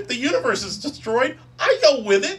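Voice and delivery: loud, high, laughing Loki rant; exasperated Loki. Retold or imitated. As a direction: rant at Thor like a frantic trickster god. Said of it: "And Loki's like".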